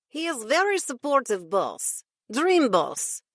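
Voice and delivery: lightly voiced Russian accent; a hint of Russian vowels. Russian assistant teasing about boats, light Russian accent. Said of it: "in a Russian accent".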